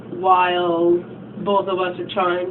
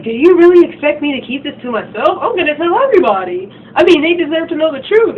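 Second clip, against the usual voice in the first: higher, louder indignant challenge; acting out an outraged girl, higher and louder; 8 semitones higher, 7 dB louder than usual. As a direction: push back hard, outraged. Heated confrontation between girls.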